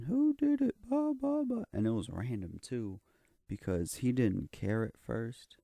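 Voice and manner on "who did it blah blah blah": high, loud mock scream of a teacher; teacher yelling at the class. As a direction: shriek it like an angry teacher. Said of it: "the teacher starts screaming".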